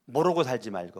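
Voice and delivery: ordinary talk, nothing marked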